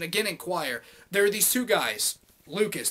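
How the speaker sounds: normal talking voice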